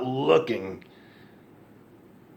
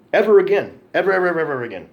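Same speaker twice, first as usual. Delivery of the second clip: louder, hammering each ever; emphatic vow; 5 semitones higher, 6 dB louder, faster than usual. Real, not acted. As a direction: pound every ever like a vow. Swearing off women, emphatic.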